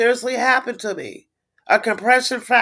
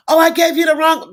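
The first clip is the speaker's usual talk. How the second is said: mock nurse; 8 semitones higher, 4 dB louder than usual. Mocking nurse's flustered admission.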